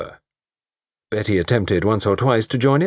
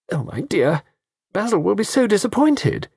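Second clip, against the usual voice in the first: high fussing mother; 13 semitones higher than usual. A narrator's reading.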